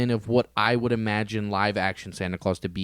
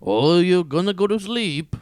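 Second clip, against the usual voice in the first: higher, louder mock Russian accent; a quick Russian-accented jab; Russian Santa accent; 7 semitones higher, 5 dB louder than usual. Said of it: "What does he say to Sandman?".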